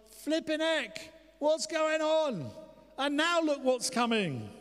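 high, loud, drawn-out mock panic; terrified disciples' outcry. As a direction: wail it in hammed-up alarm. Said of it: "They were terrified".

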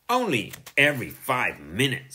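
mildly scornful sisterly jibe; scornful retort. A narrator's reading. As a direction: put him down with a touch of scorn. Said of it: "said Susie scornfully".

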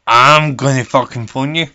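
emphatic repeat. Acted. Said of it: "I'm the fucking Angry Granny".

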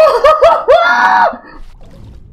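very high, loud wail; long shriek of terror; panicked scream. Real, not acted. Player scared of heights in mid-flight, long panicked scream.

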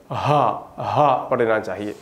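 हा from the lowest part of the throat; deep throat ha. Shown as a demonstration. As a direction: push हा up from deep down. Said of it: "गला के सबसे नीचे वाला हिस्सा से". (गले के सबसे नीचे से हा)

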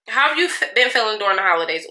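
fast, tumbling "been feeling during the holidays"; rushed question. Shown as a demonstration. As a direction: rattle it off too quickly. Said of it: "let me slow down".

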